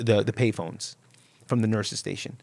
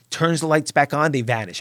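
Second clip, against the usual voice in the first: ordinary delivery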